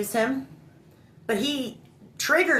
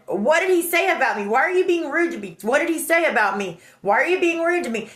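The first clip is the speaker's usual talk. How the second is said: higher, repeated indignant questions; retold protest; 6 semitones higher than usual. Asking the friend what was said about her, indignant. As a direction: demand answers, acted out.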